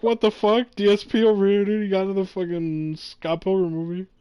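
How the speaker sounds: loud outburst. Retold or imitated. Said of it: "I'm like".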